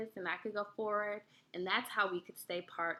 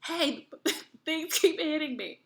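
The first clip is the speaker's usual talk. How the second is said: higher, laughing call; laughing complaint; 7 semitones higher, 4 dB louder than usual. Retold or imitated. Calling ahead to her boyfriend, laughing complaint. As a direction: laugh while complaining about the branches.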